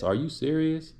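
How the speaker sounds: ordinary delivery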